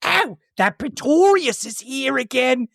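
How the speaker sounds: a lightly voiced grumbling maid; Minnie's complaint. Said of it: "Minnie comes up and is like".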